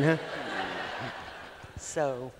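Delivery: ordinary talk, nothing marked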